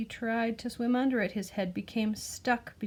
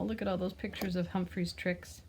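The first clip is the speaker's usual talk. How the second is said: usual speaking voice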